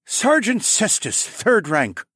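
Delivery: high, slow formal soldier voice; lizard sergeant. Acted. Sergeant naming himself to Alex, high stiff voice.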